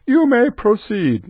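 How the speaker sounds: very high, slow announcement; high, drawn out and singsong; high sing-song. Shown as a demonstration. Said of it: "No, it never says it like that".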